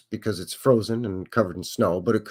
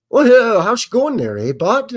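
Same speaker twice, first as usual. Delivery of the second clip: put-on playful Canadian accent, pitched higher and louder; joking Canadian accent; mock Canadian accent; 11 semitones higher, 7 dB louder than usual. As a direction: ham up a Canadian greeting with a big eh. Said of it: "so now we could just talk Canadian".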